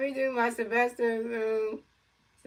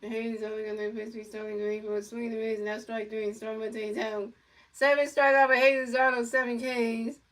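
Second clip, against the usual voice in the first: play-by-play announcer. Pretend. Kid calling a pretend Mets game, announcer style. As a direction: pretend baseball commentator calling the pitches.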